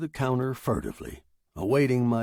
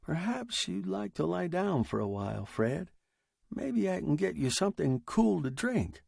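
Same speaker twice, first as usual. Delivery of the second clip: soft, higher-pitched soothing offer; concerned friend; 6 semitones higher, 4 dB quieter than usual. Acted. Perry offering Fred a rest and drink, softly soothing. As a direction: gently humor an agitated friend.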